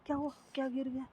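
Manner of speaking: a sudden confused shout on waking; quick stammering alarm; startled awake. Acted. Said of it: "Megha ज़ोर से उठती है".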